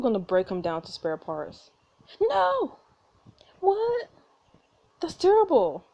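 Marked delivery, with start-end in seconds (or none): 2.2-5.9 put-on high-pitched kid protest; high, appalled little-kid voice; upset child. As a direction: protest in horror like a small child. Child upset robot will be scrapped.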